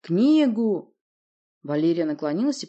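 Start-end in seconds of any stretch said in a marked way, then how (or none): 0.0-1.0 loud, high, one-word demand; forceful raised order; demanding shout. Acted. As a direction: shout for it impatiently. Acted quarrel over the book.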